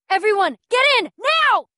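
high, loud urgent order; frantic command; commanding shout. Acted. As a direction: yell at the crowd to hurry inside. Ordering everyone into shelter.

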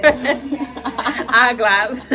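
Eyeglass said through laughter; laughing Liberian accent. Shown as a demonstration. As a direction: Liberian word with a giggle. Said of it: "Liberian accent".

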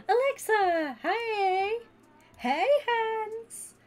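loud, high-pitched shouted hello; excited greeting. Real, not acted. Streamer greets Alexa on arrival, excited high shout. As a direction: call out a big hello, high and drawn out.

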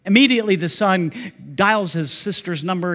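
normal talking voice

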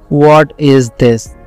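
ordinary talk, nothing marked

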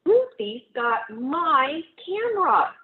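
put-on upset boy. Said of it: "cried Martin".

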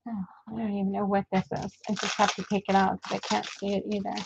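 quick hushed whisper to herself, rushing; fast whisper. Real, not acted. Digging out an unreadable item, a quick whisper to herself. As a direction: mutter it under her breath, fast.

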